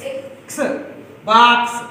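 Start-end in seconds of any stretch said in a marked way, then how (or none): 0.1-0.6 "ఎక్స్" as a voiceless "ks"; letter sound of X. Shown as a demonstration. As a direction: say only the ks sound for "ఎక్స్", without the opening vowel. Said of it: "ఎక్స్ కాబట్టి ఎక్స్". ("ఎక్స్"ను క్స్ శబ్దంగా మాత్రమే పలకడం)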